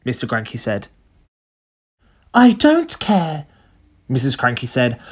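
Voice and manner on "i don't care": high, slow woman's voice; stubborn mother. A narrator's reading. Missus Cranky brushing off her husband, stubborn. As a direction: draw it out stubbornly.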